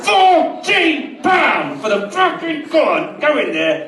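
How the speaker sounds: high, loud, slow shouting rant; raging shout over the price of food; furious rant. Retold or imitated. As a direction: roar it at the cinema bosses. Ranting at cinema bosses.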